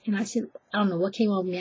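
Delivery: usual speaking voice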